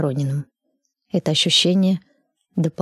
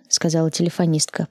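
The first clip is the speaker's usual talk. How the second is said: plain reading voice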